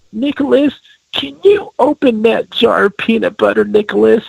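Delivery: a hint of a sweet grandma; mock grandma asking her grandson. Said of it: "He'll be like".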